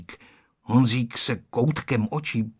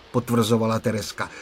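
usual speaking voice

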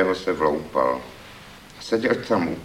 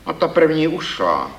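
strict, raised verdict of a critic; stern; 10 semitones higher, 4 dB louder than usual. A narrator's reading. Critic judging poet's verses, stern and raised. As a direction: pronounce judgement sternly, pitched up. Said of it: "pravil jsem přísně".

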